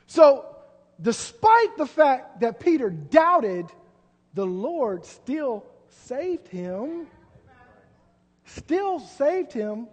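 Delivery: very slow, drawn out; slow, deliberate preaching emphasis; slow emphatic. Acted. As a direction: stretch every word of "still saved him".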